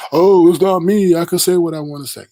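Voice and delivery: mock innocent protest; mocking Brianna. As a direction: throw her words back at her sarcastically.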